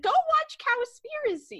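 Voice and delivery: pointed retort. Real, not acted.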